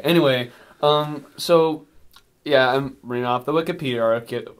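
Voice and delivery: ordinary talk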